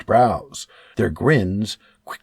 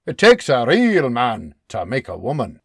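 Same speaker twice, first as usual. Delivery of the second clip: gruff barking man; 5 dB louder than usual. Acted. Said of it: "Beebe barks".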